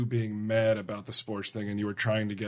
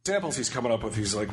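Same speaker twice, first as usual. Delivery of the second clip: ordinary delivery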